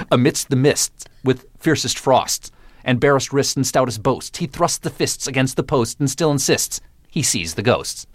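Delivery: every consonant crisply articulated, stage-actor style; crisp diction exercise. Shown as a demonstration. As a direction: recite it like a vocal drill, each s and t sharp. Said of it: "Is that an acting exercise for diction?".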